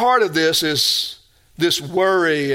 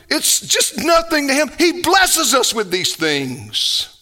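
loud-hearted, raised-pitch pulpit exhortation; forceful preaching; 11 semitones higher, 5 dB louder than usual. Real, not acted. Pastor admonishing believers to quit living like unbelievers, forceful preaching. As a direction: preach it hard, driving each point home.

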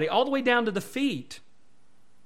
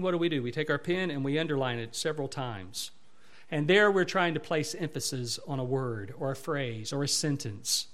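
normal talking voice

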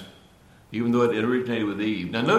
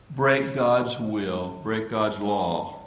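1 slow emphatic; much slower than usual. Real, not acted. Preacher slows to stress point.

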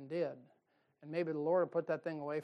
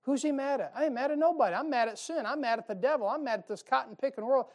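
higher and louder, righteous anger building; rising pulpit indignation; fired-up preaching; 5 semitones higher, 4 dB louder than usual. Real, not acted. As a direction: preach it hot against sin. Preacher declares his anger.

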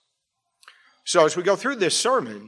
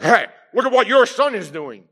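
higher and louder mock complaint to a spouse; complaining parent; 5 semitones higher, 6 dB louder than usual. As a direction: hand the blame to your partner. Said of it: "it's like".

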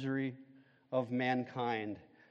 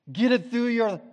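high, louder push on get; emphatic; 9 semitones higher, 7 dB louder than usual. Shown as a demonstration. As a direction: punch out get it through your. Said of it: "like we like to say".

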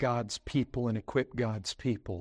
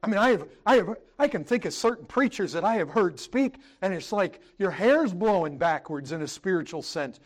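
raised, driving pulpit voice; full-force preaching; 8 semitones higher, 5 dB louder than usual. Acted. Pastor preaching to the brethren, raised and driving. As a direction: preach it hard to the congregation.

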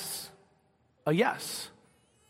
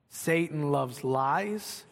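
slow emphasis; 4 semitones lower, 4 dB quieter, much slower than usual. Shown as a demonstration. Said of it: "slow emphatic restatement".

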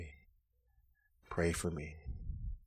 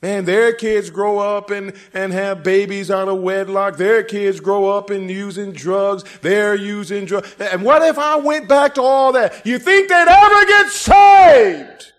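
high, loud, fiery preacher's cry; a pulpit shout that rises and rises; full-force preaching; 12 semitones higher, 20 dB louder, slower than usual. Acted. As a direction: preach it hard to the back pew.

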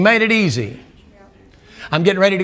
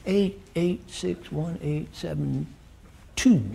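softer and slow, each digit drawn out; slow quiet recital; 8 dB quieter, slower than usual. Shown as a demonstration. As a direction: recite the number softly, slowly, as if remembering. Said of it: "That was my number in Tennessee".

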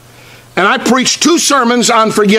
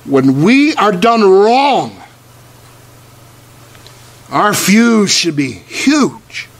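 slow earnest preaching; slower than usual. Acted.

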